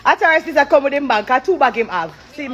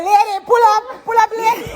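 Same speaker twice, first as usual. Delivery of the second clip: higher, pleading call of the man; mock pleading; 9 semitones higher than usual. Quoting the late traveller to the DJ, mock plea. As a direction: imitate him begging. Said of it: "I me say".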